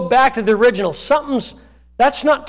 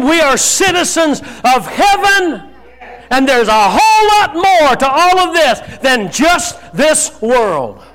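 normal talking voice

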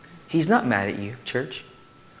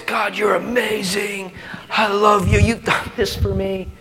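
high, loud, adoring cry to God; elated, much higher and louder praise; gushing praise; 10 semitones higher, 7 dB louder than usual. Retold or imitated. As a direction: pour out praise like a lovestruck psalmist. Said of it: "The dude was like".

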